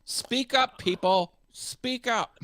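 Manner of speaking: high, loud demand to speak up. Shown as a demonstration. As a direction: shout it high. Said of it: "People who don't enunciate".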